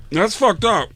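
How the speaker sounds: high, wounded Tracy voice; hurt Tracy. Retold or imitated. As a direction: sulk like a hurt star. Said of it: "he goes".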